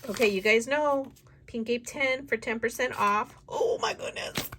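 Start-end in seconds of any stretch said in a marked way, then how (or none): 3.5-4.5 breathless squeal turning into quick giddy chatter; bubbling over with excitement; giddy anticipation. Real, not acted. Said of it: "I am so giddy right now".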